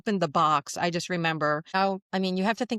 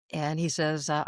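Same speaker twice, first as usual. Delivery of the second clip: ordinary talk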